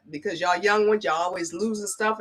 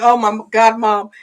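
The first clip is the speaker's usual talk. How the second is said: imitating relieved son; 3 semitones higher, 9 dB louder than usual.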